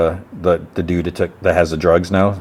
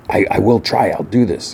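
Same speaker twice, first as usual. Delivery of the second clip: ordinary delivery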